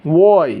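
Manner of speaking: ওয়াই with a rounded "wo" start. Shown as a demonstration. Teacher giving a last model of Y, rounded. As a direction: glide from o to i in ওয়াই. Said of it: "এরকম উচ্চারণ".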